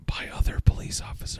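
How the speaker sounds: voiceless, quick whisper; whispered aside. A narrator's reading. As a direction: drop into a whisper as if confiding it. Hushed aside while reading letter aloud.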